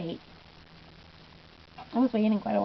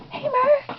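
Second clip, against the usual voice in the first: much higher, excited squeal of a fan; squealing fan greeting. Retold or imitated. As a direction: call out his name like a thrilled fan. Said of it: "I was just like".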